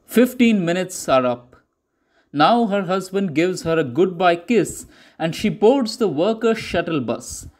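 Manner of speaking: usual speaking voice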